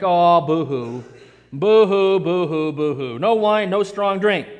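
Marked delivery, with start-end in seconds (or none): 0.0-3.2 slow mock sobbing, sinking lower; mock boo-hoo. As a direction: pretend pity, drooping down in pitch. Mock crying over no wine.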